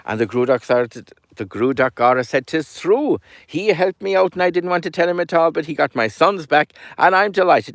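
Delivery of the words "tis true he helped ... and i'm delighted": higher, lively and glad; beaming with joy as he vouches; delighted old man. Acted. Gruagach happily confirms.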